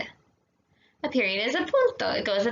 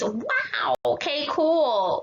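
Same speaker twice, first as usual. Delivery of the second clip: mock amazement; 4 semitones higher, much slower than usual. Shown as a demonstration. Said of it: "you're amazed".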